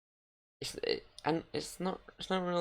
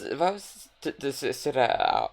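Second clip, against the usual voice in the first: ordinary talk, nothing marked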